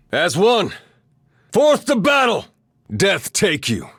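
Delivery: high, rallying war shout; fierce combat call; battle cry. Acted. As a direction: rouse allies into the charge. Battle cries in game.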